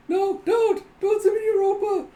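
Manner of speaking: very high, loud hysterical cry; hammed-up terror wail; acted panicked plea. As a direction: beg loudly as if dragged away.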